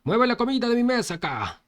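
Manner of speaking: bossy shouted order to the waiter; loud shout. Acted. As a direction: shout to the waiter across the inn. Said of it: "gritó fuerte".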